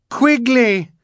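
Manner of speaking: desperate high scream. A narrator's reading. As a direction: scream the name again. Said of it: "Violet screamed again".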